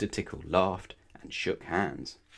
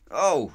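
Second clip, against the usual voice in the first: high, louder startled "oh"; alarmed squeak; 6 semitones higher, 6 dB louder than usual. A narrator's reading. Mister Grumpy suddenly tickled in the shop, squeaking. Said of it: "squeaked Mister Grumpy in alarm".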